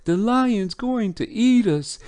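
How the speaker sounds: put-on high, loud child voice in play-fright; screaming little boys. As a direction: mimic children squealing that a lion is after them. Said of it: "the little boys are screaming".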